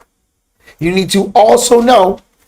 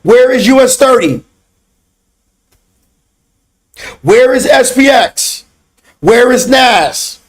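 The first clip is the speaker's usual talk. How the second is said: high, forceful barked questions and orders; drill-instructor bark; 7 semitones higher, slower than usual. Acted. Instructor calling out students for push-ups, drill-sergeant bark.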